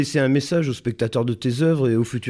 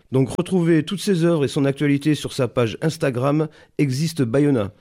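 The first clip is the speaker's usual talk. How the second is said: a light radio sign-off read; podcast outro; 4 semitones higher than usual. Acted. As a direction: wrap up the show for the listeners. (annonce de fin d'émission)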